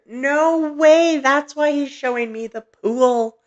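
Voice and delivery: high, loud burst of astonishment; can't believe it, pitch soaring; amazed delight. Real, not acted. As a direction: exclaim it loudly, drawing out the surprise. Surprised by a listener's message.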